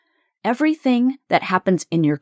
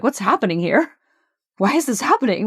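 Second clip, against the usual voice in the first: mild shock, pitch climbing on the second question; acted-out startled disbelief; 4 semitones higher, faster than usual. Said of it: "we're kind of in shock".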